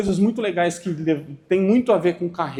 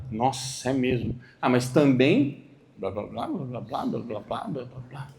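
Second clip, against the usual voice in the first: lower, fading blah blah; dismissive blah-blah trailing into near silence; mock excuses; 7 semitones lower, 5 dB quieter than usual. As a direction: mumble excuses, dying off. Mocking excuse-maker.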